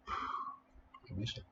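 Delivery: breathy whisper, slow. Real, not acted. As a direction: whisper it slowly to yourself. Echoing the line about himself.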